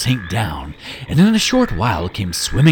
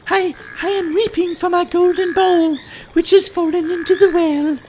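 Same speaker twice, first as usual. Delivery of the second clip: put-on very high, loud girlish princess; young princess voice; 4 dB louder than usual. Princess surprised to find the frog speaking, very high girlish voice. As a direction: squeak it out like a startled little princess.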